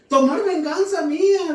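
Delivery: high, loud cry for vengeance; Samson demanding revenge. Retold or imitated. Samson begging God for revenge, high loud cry. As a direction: fling the demand for revenge upward.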